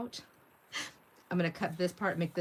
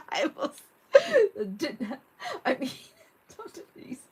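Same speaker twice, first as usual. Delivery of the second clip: helpless laughter; 9 dB louder, slower than usual. Real, not acted. Cracking up at the chat.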